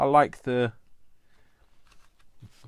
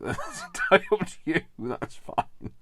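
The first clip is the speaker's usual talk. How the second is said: laughing; 9 semitones higher than usual. Real, not acted. Laughing at own innuendo.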